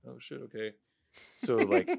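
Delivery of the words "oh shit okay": very soft acted reply; subdued little retold answer; hushed compliance. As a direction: play it small and quiet, going along. His reply to her plea.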